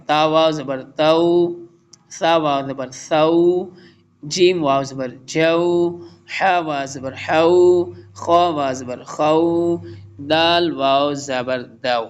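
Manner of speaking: slow careful recitation, तऊ said short, not stretched; short vowel recitation. Shown as a demonstration. Teacher reciting slowly for the students, keeping the vowel short. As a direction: chant each letter combination slowly with a short ending. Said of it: "बल्कि short ही पढ़ेंगे".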